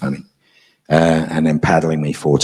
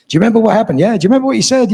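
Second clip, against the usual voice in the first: eager questions; 4 dB louder, much faster than usual. Retold or imitated. Staff quizzing him eagerly.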